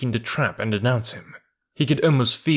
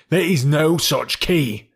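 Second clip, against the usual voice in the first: insistent denial; 6 semitones higher, 4 dB louder, slower than usual. A narrator's reading.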